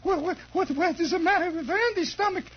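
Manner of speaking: put-on high, fluttery Hungarian accent; nervous Hungarian lady. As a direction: stammer anxiously with a mock Hungarian accent. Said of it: "Hungarian lady who hardly spoke it".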